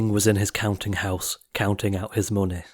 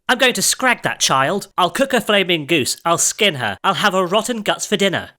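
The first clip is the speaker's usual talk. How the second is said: queen yelling; 8 semitones higher than usual. A narrator's reading. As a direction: spit out the threats in a rage. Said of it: "She yelled".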